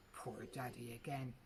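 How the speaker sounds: ordinary delivery, nothing marked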